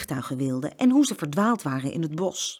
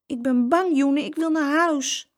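higher, louder frightened plea; scared child; 9 semitones higher, 4 dB louder than usual. A narrator's reading. Frightened girl telling her brother she wants home, scared plea. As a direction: plead like a lost, frightened child.

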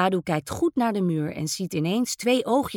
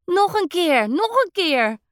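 put-on excited child calling, louder and higher; boy wants to play again, excited call; eager call; 8 semitones higher, 4 dB louder than usual. A narrator's reading. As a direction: beg for more, bouncing with glee. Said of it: "roept Dadu".

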